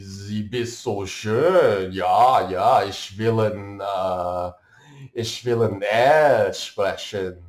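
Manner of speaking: loud, slow, hammed-up German accent; German accent, mock-formal and loud, drawn out. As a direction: ham up a German guy at the club, all stiff formality. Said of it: "they'll be like".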